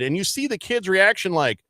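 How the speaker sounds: ordinary talk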